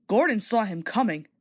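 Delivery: usual speaking voice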